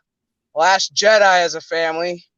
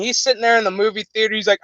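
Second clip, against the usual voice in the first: ordinary talk, nothing marked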